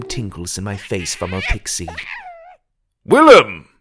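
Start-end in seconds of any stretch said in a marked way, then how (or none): 3.0-3.7 high, loud call from below. Acted. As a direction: shout the name up to him. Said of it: "a voice called from below".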